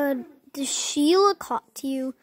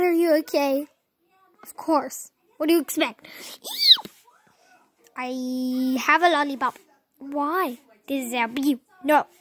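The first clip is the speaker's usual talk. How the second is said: usual speaking voice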